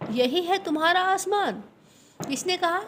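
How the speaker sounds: high, amused fox voice pointing at the fruit; a sly fox laughing at the silly animals; mocking fox. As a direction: tease them like a sly fox. Fox mocks the scared animals.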